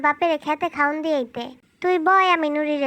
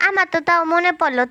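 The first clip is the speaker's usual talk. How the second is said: normal talking voice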